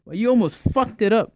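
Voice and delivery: high-pitched, indignant retold complaint; exasperated reproach. Telling off friends after the date, exasperated. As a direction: scold your buddies, half in fun.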